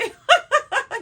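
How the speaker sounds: loud, high burst of laughter. Real, not acted. Vlogger laughing at her scream joke, loud and high. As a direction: crack up at her own joke.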